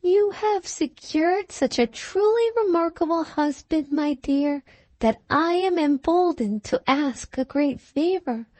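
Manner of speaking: high-pitched, mock-polite bitterness; bitter. A narrator's reading. Offended friend's parting words to the bride, high and bitter. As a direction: ask the favor high, with a bitter edge. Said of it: "Her last words were the bitterest words".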